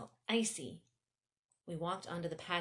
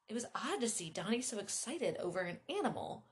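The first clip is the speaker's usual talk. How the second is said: usual speaking voice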